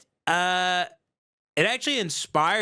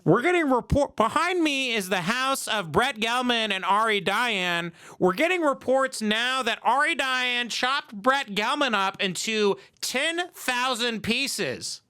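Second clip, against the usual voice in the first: higher-pitched on-the-scene reporter parody; mock news reporter; 5 semitones higher than usual. Reporter outside the house breaking the news, mock newscast. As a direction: play a live TV correspondent breaking news.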